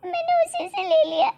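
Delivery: high, loud wailing apology; tearful pleading. Acted. Daughter-in-law begging father-in-law's forgiveness, crying. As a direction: plead through tears.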